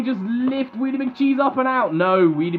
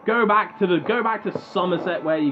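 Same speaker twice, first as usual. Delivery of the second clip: lower-pitched, hammed-up Somerset accent; bad Somerset accent; 3 semitones lower than usual. Commentator mocking a robot's home county, bad Somerset accent. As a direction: put on a rough imitation of Somerset speech. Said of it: "my shitty Somerset accent".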